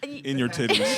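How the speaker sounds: laughing. Real, not acted. Echoing the joke, laughing.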